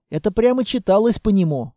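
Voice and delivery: plain reading voice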